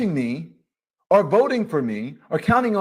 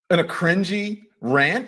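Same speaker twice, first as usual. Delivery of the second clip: ordinary delivery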